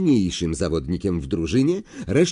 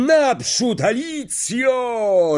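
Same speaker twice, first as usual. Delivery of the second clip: much higher drawn-out roar; 12 semitones higher, much slower than usual. Acted. Lee to Alicia in the silence, roaring. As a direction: roar her on. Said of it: "ryknął Lee".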